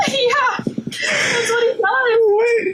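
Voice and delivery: slow, high, laughing through the words. Real, not acted. Cohost confirming the absurd plot, laughing hard. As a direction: crack up as you confirm it.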